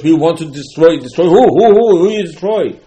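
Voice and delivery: a king's loud demand, rising; demanding question. Retold or imitated. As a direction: insist loudly on knowing who. Acted exchange with Achashverosh.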